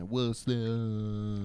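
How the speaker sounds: very slow, stretched "whassup"; lazy, goofy catchphrase; drawn-out catchphrase. Acted. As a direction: pull the last vowel way out.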